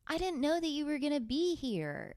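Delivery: high, hammed-up shock at unexpected guests; mock surprise. Host faking surprise that listeners showed up, high mock shock. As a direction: squeal it like you've been caught off guard.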